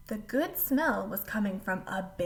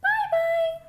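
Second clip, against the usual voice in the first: very high, louder squeaky farewell; squeaky little voice; 7 dB louder than usual. A narrator's reading. Baby bear calls goodbye, squeaky. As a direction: squeak it like a tiny cub. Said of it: "a squeaky little voice".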